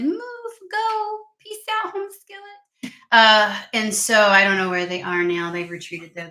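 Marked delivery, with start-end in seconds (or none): 0.0-2.9 much higher, slow and gentle, shooing spiders away. Retold or imitated. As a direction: coax the spiders out with a sweet high voice. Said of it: "I just gently said".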